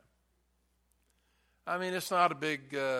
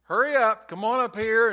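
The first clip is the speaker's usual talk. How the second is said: higher, louder imitation of a father calling; raised, urgent mock shout; acted yell downstairs; 6 semitones higher, 6 dB louder than usual. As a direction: holler it like a dad hurrying his son. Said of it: "the father yelled downstairs".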